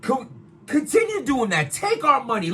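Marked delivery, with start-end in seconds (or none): none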